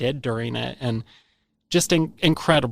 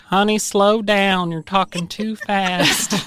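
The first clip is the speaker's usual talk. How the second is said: higher, drawn-out folksy imitation; imitated slow-talking local; 6 semitones higher, slower than usual. Locals to a fast-talking youngster, slow mock chiding. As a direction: stretch it out slowly as a chiding local. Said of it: "I got that a lot growing up".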